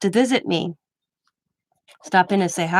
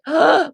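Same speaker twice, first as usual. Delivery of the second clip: swooning gasp; 8 semitones higher, 4 dB louder than usual. Real, not acted. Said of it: "swooning gasp at accent".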